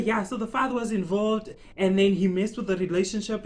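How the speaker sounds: ordinary delivery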